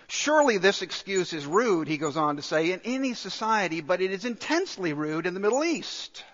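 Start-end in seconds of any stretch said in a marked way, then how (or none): none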